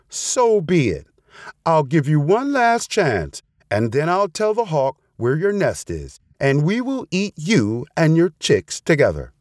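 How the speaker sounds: a hint of storybook menace, high-pitched; threatening fox. A narrator's reading.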